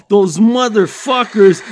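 ordinary talk, nothing marked

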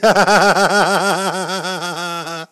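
loud, high staccato laugh running on and on; prolonged ha-ha-ha, fading at the end; long laugh. Real, not acted. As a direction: let out a long rattling laugh that tails off. Laughs after thanking the listener.

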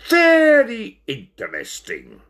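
high, slow, drawn-out fascination; greatly intrigued. Acted. Ringmaster spotting a star in Binnicky, high and fascinated. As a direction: launch high on the first word, relishing it slowly.

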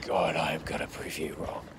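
low, quiet vow; retold angry determination, held down under the breath; mad resolve. Son vowing to prove dad wrong, quietly mad. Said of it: "I was so mad".

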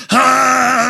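hammed-up high loud "Ah" of relief; relieved gasp. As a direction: gasp out loud, like a weight falls off. Bowler taking chain off.

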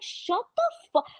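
high, sharp mock snap. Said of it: "I'm just like".

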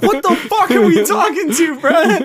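bewildered outburst cracking up; mock baffled Klansman. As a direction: Klansman baffled by the caller, laughing. Klansman reacting, laughing.

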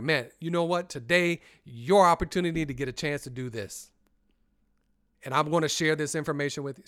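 loud, high-pitched motivational burst; exciting, energetic. Shown as a demonstration. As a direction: fire them up, punching it out. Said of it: "It's more exciting. It's more energetic".

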